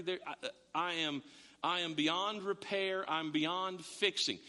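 grave and halting, as if beyond saving; acted despair. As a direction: sink into hopeless self-condemnation. Acts sinner realizing they are doomed.

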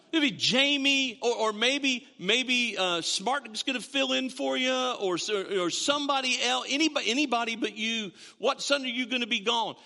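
mock-earnest, hesitant hinting; imagined tactless churchgoer. Imagined churchgoer rambles through other preachers.

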